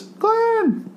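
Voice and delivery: high yelled name, hammed up; mock yell of a name. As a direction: call out Glenn as if panicking. Quoting the show's shout.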